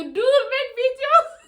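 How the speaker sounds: slow, high and loud through laughter; breaking up with laughter on the quote; laughing quote. Real, not acted. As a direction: squeal it out while laughing. Laughing while quoting.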